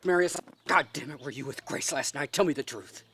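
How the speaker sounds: loud curse, then firm interrogation; angry demand. Acted. Director demanding the truth from an actor about last night, angry. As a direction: confront him and demand an answer.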